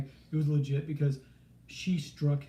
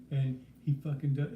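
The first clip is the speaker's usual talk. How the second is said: ordinary delivery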